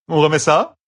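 high, bright, smiling challenge. Acted. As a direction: toss it off with a grin. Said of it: "lança-t-il avec un sourire".

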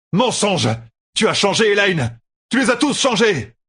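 high, anguished yell; accusing shout. A narrator's reading. Rand blames the dark one for Elaine, shouting. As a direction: hurl each accusation at full pitch.

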